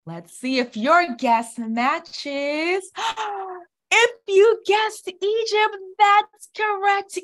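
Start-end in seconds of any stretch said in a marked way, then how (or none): 3.0-3.6 airy theatrical gasp; mock gasp. Teacher gasps before revealing Egypt. 3.9-7.2 high, loud triumphant reveal; excited. Acted. Teacher reveals the right stamp, excited.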